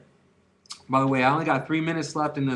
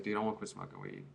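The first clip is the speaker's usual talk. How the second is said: much lower, much softer and murmured; a low murmured announcement to the guys; mumbled resolve; 12 dB quieter than usual. Retold or imitated. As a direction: drop way down, almost under the breath. Announcing his quit to friends.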